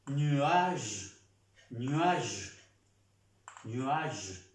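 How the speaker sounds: "nuage" in French, falling onto a zh ending; "nuage" with the final E dropped, G as zh; silent final E. Shown as a demonstration. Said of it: "No se pronuncia la E".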